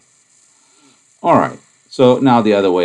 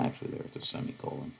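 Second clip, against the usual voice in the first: whispered aside; 4 semitones lower, 20 dB quieter, faster than usual. Real, not acted. Quick aside, unvoiced.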